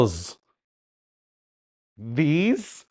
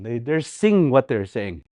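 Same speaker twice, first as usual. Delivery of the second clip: ordinary talk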